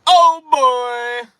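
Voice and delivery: high, loud excited shout. Acted. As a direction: shout it out with glee. Excited announcement.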